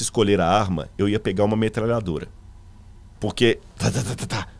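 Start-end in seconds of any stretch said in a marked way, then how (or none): 3.8-4.5 rapid rat-a-tat mouth noise; staccato machine-gun imitation; imitated machine-gun fire. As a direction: fire off a quick burst of gun sounds.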